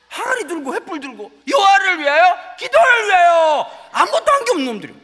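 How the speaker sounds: loud, high, full-force preaching cry; fiery preaching at full force; preaching shout. Real, not acted. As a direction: shout it out from the pulpit.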